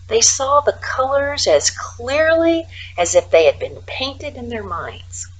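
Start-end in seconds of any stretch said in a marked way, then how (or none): 3.6-5.3 whispered ending. A narrator's reading. Describing colors painted in minds, whispered. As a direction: let the phrase fade into a whisper. Hushed storytelling.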